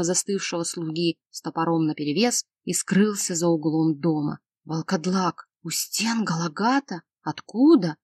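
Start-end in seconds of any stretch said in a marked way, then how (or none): none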